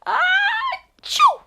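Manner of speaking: loud, high-pitched acted sneeze; exaggerated storybook ah-choo; big sneeze. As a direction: build up, then burst out with a sneeze. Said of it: "Humbert had a big sneeze".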